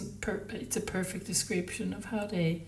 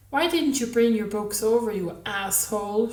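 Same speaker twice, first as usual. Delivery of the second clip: exasperated snap; 3 semitones higher, 8 dB louder than usual. A narrator's reading. Woman snapping at him in car.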